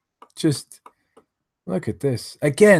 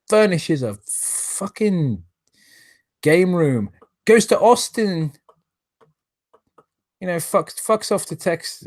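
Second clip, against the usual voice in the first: indignant rant; 6 semitones higher, slower than usual. Real, not acted. Indignant ranting about trip.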